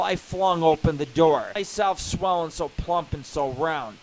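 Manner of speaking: loud, theatrical poem reading; loud, sung-out finish over music; dramatic poem recital. Acted. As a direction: declaim it like a bawdy epic. Performs his poem.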